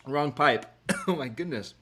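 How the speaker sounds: coughing on a drink down the wrong pipe; choking cough. Real, not acted. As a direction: sputter and cough, then recover. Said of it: "That really went down the wrong pipe".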